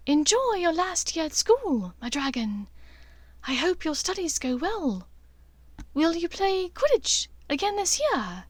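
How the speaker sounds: a mother sending her son off; lightly voiced mother. A narrator's reading.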